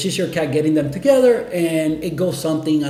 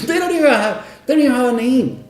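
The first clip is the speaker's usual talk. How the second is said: laughing disbelief; 8 semitones higher, 3 dB louder, faster than usual. Real, not acted. Laughing at the absurd system.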